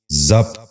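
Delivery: ضبط in one syllable, "zabt"; correct pronunciation. Shown as a demonstration. Teacher giving viewers the model, correct pronunciation. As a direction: say ضبط as zabt, no second vowel. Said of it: "دوبارہ سنیے".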